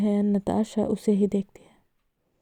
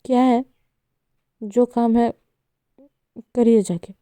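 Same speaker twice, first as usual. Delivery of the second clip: louder, snapping irritated yell; 3 semitones higher, 5 dB louder than usual. Acted. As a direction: throw it at her, annoyed. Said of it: "Saanvi चिल्लाकर कहती है".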